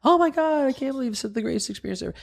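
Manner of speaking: high-pitched, rushed gushing customer voice; gushing female customer. Retold or imitated. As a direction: imitate a delighted customer in a squeaky high voice. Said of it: "if you got a female customer".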